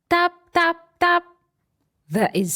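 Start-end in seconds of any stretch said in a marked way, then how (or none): 0.1-1.2 high, light tapping voice; knocking sound. Acted. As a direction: tap it out like a knock.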